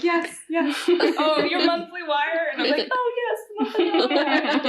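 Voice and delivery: high, laughing bank chat; breezy and high, through a laugh; cheery familiar reply. Retold or imitated.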